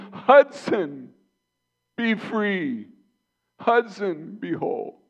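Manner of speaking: loud high call of a name, fading to a whisper on the last words; a declared prayer that ends hushed; commanding prayer. Pretend. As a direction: proclaim the healing, then let it drop to breath. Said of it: "I just want to speak the name".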